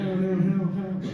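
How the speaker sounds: mock lazy no's; barely trying. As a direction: toss it off without effort. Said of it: "barely trying".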